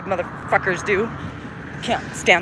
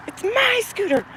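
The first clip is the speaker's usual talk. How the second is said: put-on whiny child's protest, pitched way up; screaming little kid; 12 semitones higher, 3 dB quieter, slower than usual. Little kid fighting a sibling over a scooter, mock screaming. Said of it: "One is saying, ... screaming at each other".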